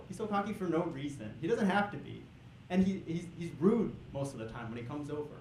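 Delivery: ordinary talk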